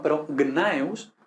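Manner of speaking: gnaeus with G and N both sounded, slowly; slow careful GN. Shown as a demonstration. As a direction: ease into gnaeus, G before N. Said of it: "esto es difícil en español".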